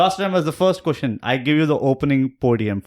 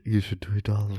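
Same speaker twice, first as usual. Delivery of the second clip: hushed mock whisper, softer; imitating a whisper; 5 semitones lower, 8 dB quieter than usual. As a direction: echo the whisper back teasingly. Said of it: "Why are you whispering".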